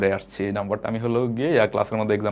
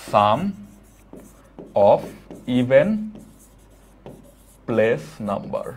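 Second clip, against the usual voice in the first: "sum of even place numbers" spaced out word by word, very slowly; very slow dictation; much slower than usual. Shown as a demonstration. Teacher reads the rule to students, very slow dictation. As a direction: spell out each word slowly so they can copy it. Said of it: "আমি কিন্তু খুব আস্তে আস্তে বলবো".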